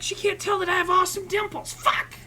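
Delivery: high, loud mock anguish; frustrated outburst. Man behind a mask with a cute customer, loud high frustration. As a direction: cry out a comic inner lament. Acted thought about masked encounter.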